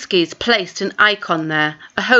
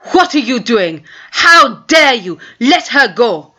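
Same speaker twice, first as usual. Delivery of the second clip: angry outburst; 4 semitones higher, 5 dB louder than usual. A narrator's reading. Sergei bursts in on bullies.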